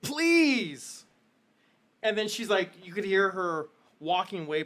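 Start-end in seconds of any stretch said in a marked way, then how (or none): 0.0-1.0 annoyed son. Acted. Son shoos his mother.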